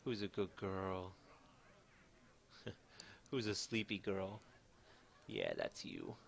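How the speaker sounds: slow cooing puppy talk; talking to a dog. Real, not acted.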